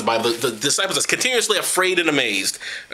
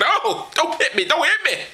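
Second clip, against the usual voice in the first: much higher, slow whimpering plea; mock begging; 13 semitones higher, slower than usual. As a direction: cower and beg in a high whine. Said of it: "Mel Gibson shit".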